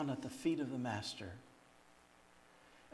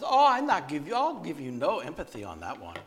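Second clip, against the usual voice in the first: mock indignant refusal; 8 dB louder than usual. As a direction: exclaim it as a no-nonsense woman refusing. Retold stern woman refuses empathy.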